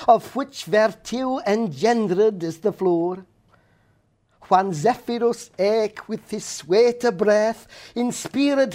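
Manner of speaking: Chaucer recited in reconstructed Middle English. Shown as a demonstration. Scholar reciting Chaucer's Prologue in Middle English. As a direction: recite the Prologue with Scots-like vowels. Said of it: "this is what I've opted for".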